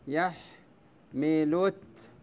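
يحملت drawn out very slowly with a rising end, ending on a clear ت; slow scansion. Shown as a demonstration. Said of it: "بعد اللام ماذا نطقت؟". (يحملت ببطء شديد مع ترقيق التاء الساكنة)

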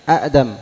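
أأدم with a stop inside the first syllable; original double hamza. Shown as a demonstration. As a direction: break أأدم as 'a'dam. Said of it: "التي كانت من".

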